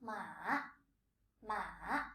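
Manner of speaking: drawn-out low "Ma" that dips and climbs; Mandarin third tone. Shown as a demonstration. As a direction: scoop "Ma" low, then up. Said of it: "dips a little, and rises up".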